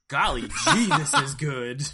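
put-on loud, laughing golly-gee kid voice; wholesome 1950s kid. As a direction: gush like an aw-shucks cartoon cowboy. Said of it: "human form of Woody from Toy Story".

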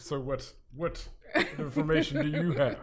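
light questioning professor; professor voice, first take. Retold or imitated. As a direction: ask it like a doubting teacher. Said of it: "Do that again".

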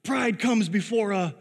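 high-pitched, slowing imitation of a preachy counselor; mock preaching. Counselor standing over a camper on a rock, mock preaching. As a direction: sermonize at someone, drawn out and high. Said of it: "he's trying to preach at me".

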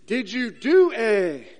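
slow, loud questioner pressing the point; questioner of politician. Acted. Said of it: "politicians are good at this".

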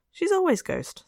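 put-on a small girl stamping her point; insistent little girl. Marion insisting to her aunt, high childish protest.

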